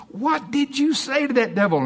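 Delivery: high-pitched, pleading question; mock astonishment, voice way up; desperate curiosity. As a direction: imitate eager onlookers demanding the secret. Onlookers begging Dave to tell.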